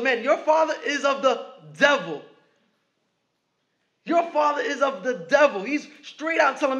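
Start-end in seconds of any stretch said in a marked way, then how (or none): 0.0-2.2 a hint of Jesus rebuking, raised and pointed. Jesus rebuking the Pharisees, a touch louder and pointed. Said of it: "He goes". 4.0-5.6 a hint of Jesus rebuking, raised and pointed. Jesus rebuking the Pharisees, a touch louder and pointed. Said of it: "He goes".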